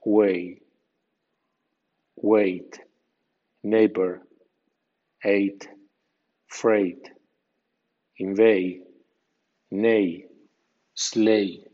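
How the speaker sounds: weight, neighbor, freight with a clear "ei" sound, gh silent; English pronunciation demo. Shown as a demonstration. Teacher models eigh words for Spanish learners, careful pronunciation. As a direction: rhyme weight with way, gh unspoken. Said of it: "E I G H ... suena ei".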